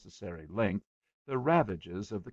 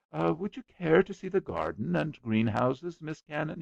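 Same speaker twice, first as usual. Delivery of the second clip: lightly voiced nervous suitor, higher; bashful young man; 8 semitones higher, 4 dB louder than usual. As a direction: ask shyly, hoping to please. Shy Robert addressing Miss Cannon.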